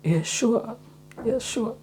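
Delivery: high, soft, friendly yes; high gentle yes, sure; high soft reply. Shown as a demonstration. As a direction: answer high and gentle, eager to help. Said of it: "എന്തായിരിക്കും സ്വാഭാവികമായും അതിൻ്റെ answer?".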